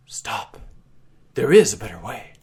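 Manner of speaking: hushed then loud ringing call from nowhere; a hidden voice halts the fight; eerie voice. Acted. Mysterious voice rings out.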